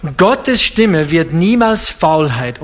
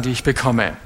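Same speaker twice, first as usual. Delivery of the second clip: usual speaking voice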